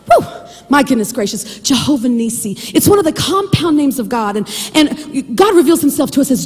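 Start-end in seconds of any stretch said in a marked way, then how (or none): none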